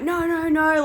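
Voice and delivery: signal you want out, in a panic; panicked. Retold or imitated. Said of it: "and then I just panicked".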